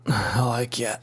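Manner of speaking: soft whispered aside; whispering. Real, not acted. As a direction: whisper it to yourself.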